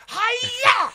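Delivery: very high, loud show-off shout; Tom Cruise yell. Retold or imitated. Narrator sinking shots showing off, high loud yell. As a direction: yell it like a pool hustler. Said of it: "that Color Money Tom, Tom Cruise shit".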